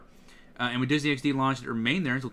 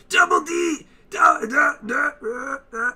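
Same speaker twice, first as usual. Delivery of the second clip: forced guttural shout, higher and louder; Eddie impression; 13 semitones higher, 7 dB louder, slower than usual. Shown as a demonstration. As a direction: push it from the throat like a bratty kid. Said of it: "so guttural".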